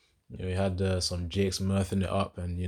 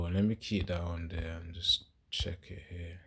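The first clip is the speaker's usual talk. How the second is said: ordinary talk, nothing marked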